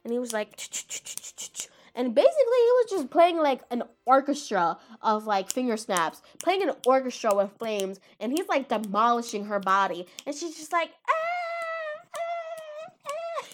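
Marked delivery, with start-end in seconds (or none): 0.6-1.7 rapid voiceless ch-ch-ch; imitated finger snaps. Anime fan imitating a flame fighter's snaps, rapid ch sounds. 11.1-13.5 very high, thin imitated scream; hammed-up squeals like a dying cat; dying-cat wail. Anime fan imitating the burning villain, mock dying-cat wail. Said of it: "I sound like a dying cat".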